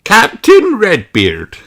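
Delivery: slow, high, loud formal address; King Thistle's voice. Acted. King offers the submarine to Redbeard. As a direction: announce it grandly as the king.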